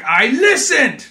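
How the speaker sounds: loud, slow, hammed-up declaration; booming movie-hero impression; mock shout. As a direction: belt it out word by word. Acting out the film hero's reply.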